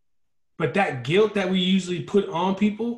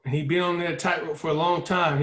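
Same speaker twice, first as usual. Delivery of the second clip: usual speaking voice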